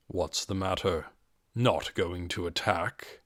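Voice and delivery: barely bother raising your voice; quiet, casual. Acted. Ainz coolly taunting the frightened ogres, quiet and casual. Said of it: "His quiet, casual voice".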